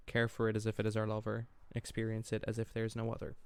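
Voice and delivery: soft, sinking poem recital; poem reading. Acted. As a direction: read it quietly, trailing line ends. Rereads and continues poem.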